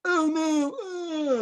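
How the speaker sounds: high, whiny near-cry of defeat; whimpering oh no. Retold or imitated. Mocking a recruit, a high whimpering cry. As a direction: whimper it like a beaten recruit about to quit.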